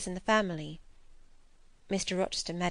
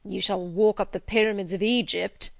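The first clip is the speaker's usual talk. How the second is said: lightly growled threat; 4 dB louder than usual. A narrator's reading. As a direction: growl it as the annoyed master, without shouting. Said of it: "he growled".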